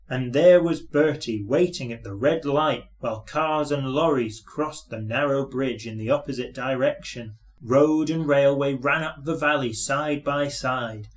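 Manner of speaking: ordinary delivery, nothing marked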